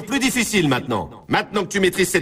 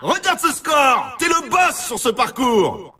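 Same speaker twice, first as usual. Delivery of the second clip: high, excited whoops of praise; thrilled cheering; 5 semitones higher than usual. Acted. Game announcer to player with top score, thrilled cheering. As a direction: hype the player up, stretching the exclamations.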